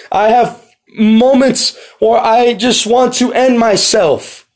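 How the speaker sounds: high, drawn-out anguished plea; crying out. Acted. Matthew the tax collector pleading with the Lord, crying out. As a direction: cry out slowly to God in desperation. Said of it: "crying out, beating his chest".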